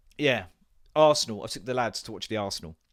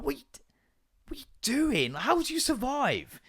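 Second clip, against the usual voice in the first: incredulous at the dogs; 12 semitones higher than usual. Retold or imitated. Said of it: "I'm like".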